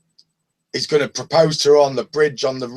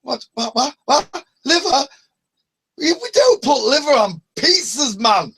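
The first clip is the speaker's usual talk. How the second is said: angry Scotsman; 9 semitones higher than usual. Retold or imitated. Angry Scottish pizza man yelling at a prank caller. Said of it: "the angry Scotsman".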